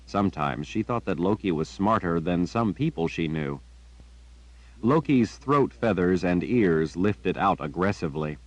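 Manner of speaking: plain reading voice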